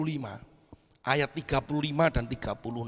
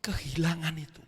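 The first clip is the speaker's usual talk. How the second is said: whisper; 2 semitones higher than usual. Real, not acted. Preacher whispering to the congregation. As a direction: drop to a whisper.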